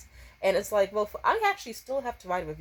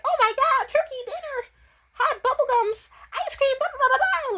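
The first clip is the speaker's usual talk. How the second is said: high squealy imitation of a dazzled girl; mock the girl gushing over flavors; 7 dB louder than usual. Mocking a girl who tastes every flavor, high and gushing. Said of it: "that girl's like".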